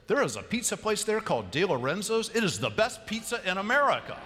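enthusiastic quote. Retold or imitated. Quoting enthusiastic man at bar.

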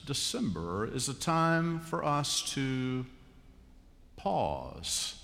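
ordinary talk

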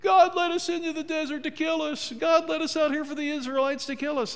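high, loud whiny complaint; whining Israelites. Retold or imitated. Israelites complaining to Moses in the desert, whining. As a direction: whine like grumbling wanderers in the desert. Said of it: "what did they whine".

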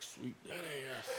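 very soft, low, grateful murmur; hushed relief. Retold or imitated. Exhausted digger hearing the backhoe is coming, hushed relief. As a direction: let it out under the breath, relieved.

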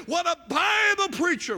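high, loud mock acclaim; imitating fervent shouted praise; imitated congregant's shout. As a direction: holler it like an excited revival crowd. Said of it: "How many times say".

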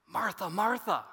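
MARTHA said twice, both pitched high; emphatic repeated name. Acted. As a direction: call "Martha, Martha" high to get attention. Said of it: "It is an emphatic use".

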